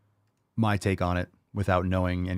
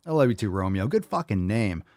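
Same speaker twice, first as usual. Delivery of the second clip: ordinary talk, nothing marked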